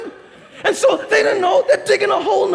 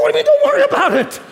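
higher, louder and fast, half laughing in disbelief; indignant disbelief; 7 semitones higher, 4 dB louder, faster than usual. Retold or imitated. Pastor to his German host, fast incredulous outburst. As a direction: fire it back in laughing outrage. Pastor balks at host's dismissal.

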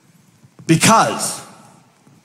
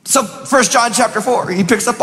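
preaching; 9 semitones higher than usual. Acted. Preacher building to a proclamation.

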